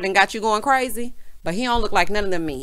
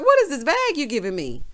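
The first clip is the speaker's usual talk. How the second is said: higher-pitched incredulous question; mock disbelief; 6 semitones higher than usual. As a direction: playfully confront the gift giver. Repeats her imagined reaction to gift.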